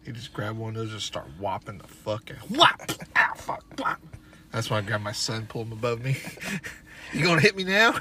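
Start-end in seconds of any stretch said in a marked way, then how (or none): 2.5-2.8 loud, high "whop"; imitated smack. Son showing dad's ruler strike, imitated smack. 3.2-4.0 loud cry at a smack; mock pained yelp. Son reliving being hit with a ruler, mock yelp. Acting pain from a blow. 7.1-7.9 high, loud taunting question; mock challenge. Son shielded behind his own son, mock challenge.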